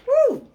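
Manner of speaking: very high loud celebratory woo; joyful whoop. Real, not acted. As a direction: let out a praise whoop. Praise break whoop.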